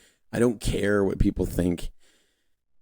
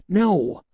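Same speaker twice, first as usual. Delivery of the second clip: high-pitched, emphatic NO; 12 semitones higher, 3 dB louder than usual. Real, not acted. Answering own question about not paying taxes, emphatic no. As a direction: jump high on "No" to dismiss the idea.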